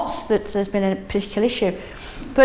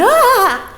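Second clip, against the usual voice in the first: a sharp high startled "Ah!"; panicked student gasp; 13 semitones higher, 6 dB louder than usual. Retold or imitated. Teacher mimicking an anxious student's sudden yelp, high and loud. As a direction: imitate a stressed student's yelp. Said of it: "if I see a student going".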